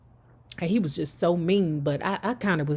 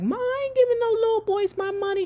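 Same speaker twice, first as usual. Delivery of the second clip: much higher, fast, whiny denial; whiny protest; faster than usual. Retold or imitated. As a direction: protest like a caught kid. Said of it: "I was like".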